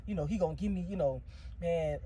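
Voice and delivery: murmured under her breath, hoping; softly worried inner voice; muttering to herself. Retold or imitated. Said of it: "saying to myself".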